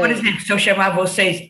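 ordinary talk

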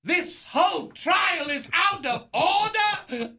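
hammy shouted outrage, slow and high; shouting Attica in a high slow rant; courtroom rant. Acted. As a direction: rant like a deranged old ham actor in court. Old actor confused, ranting in court.

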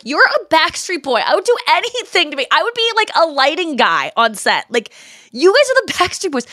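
high, fast fangirl gushing; breathless fan rush, pitched high; starstruck gushing. Retold or imitated. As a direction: gush at your idols like a starstruck fan. Gushing at Backstreet Boys on set.

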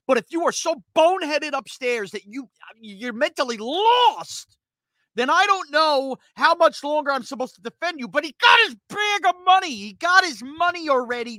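rising, shouted rant; loud exasperated outburst; fed-up rant. Real, not acted. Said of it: "I sound like a goddamn auctioneer right now".

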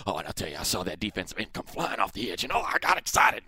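hushed manic coach imitation; mock crazed coach, rising at the end; crazy football coach. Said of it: "he's got that crazy look".

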